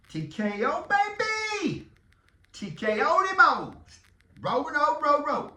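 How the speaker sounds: loud, high hype shout; rally the fans for a knockout; hyped-up cheer. Real, not acted. Hyping the coming playoff knockout.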